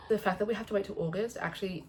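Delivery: normal talking voice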